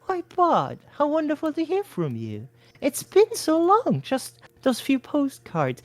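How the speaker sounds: high, slow doting mother voice. A narrator's reading. Mother pleased her son called, doting. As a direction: coo like a delighted old mother.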